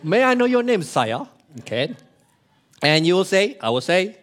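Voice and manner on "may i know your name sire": posh guard. Retold or imitated. Guard asks visitor's name.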